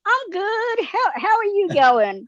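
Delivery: nervous high-pitched try at casual; failed cool. Retold or imitated. Starstruck actor greeting Hugh Jackman, nervous high squeak. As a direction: squeak it out while faking calm. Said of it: "trying to play it completely cool".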